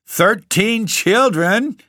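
high, drawn-out theatrical shout. A narrator's reading. The genius tops his objections to the Baron, high shout. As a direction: shout each word slowly at a very high pitch. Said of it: "shouted the genius".

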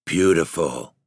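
ordinary delivery, nothing marked

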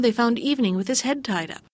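ordinary delivery, nothing marked

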